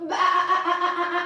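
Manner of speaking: long, pulsing baa; stuttering sheep call; sheep bleating. Pretend. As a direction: bleat out the whole breath. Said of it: "I wanna hear you bleeding".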